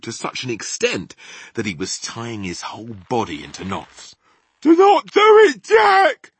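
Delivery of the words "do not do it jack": very high, loud, panicked shriek; terrified giant's scream; screech. A narrator's reading. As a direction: screech it, begging for mercy. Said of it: "he screeched".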